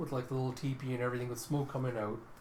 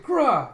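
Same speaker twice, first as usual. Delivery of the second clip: high, loud "grah" battle cry; monster roar; 11 semitones higher, 11 dB louder than usual. Retold or imitated. Morse facing the archer's next arrow, loud roar. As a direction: roar at an attacker.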